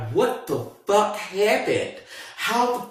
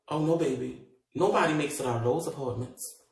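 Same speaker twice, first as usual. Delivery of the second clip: hushed detective voice; 2 semitones lower, 10 dB quieter than usual. Retold or imitated. Voicing detective softly.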